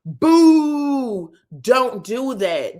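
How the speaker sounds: boo it loudly; booing the look, loud then scolding; mock boo. Real, not acted. Booing tattooed edges.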